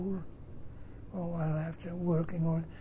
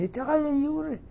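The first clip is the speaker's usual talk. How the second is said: retold astonished question, much higher; surprised professor; 10 semitones higher than usual. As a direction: echo it back in high-pitched disbelief.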